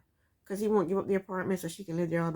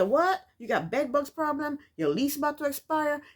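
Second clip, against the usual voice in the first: higher-pitched incredulous needling; mocking comeback; 6 semitones higher than usual. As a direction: quote yourself being mock-shocked and nosy. Acting out her mocking comeback.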